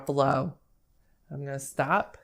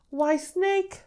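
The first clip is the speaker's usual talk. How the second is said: very high, sing-song hello; storybook mouse, pitched way up; friendly mouse greeting; 6 dB louder, much slower than usual. Acted. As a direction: greet the snake in a high, playful voice.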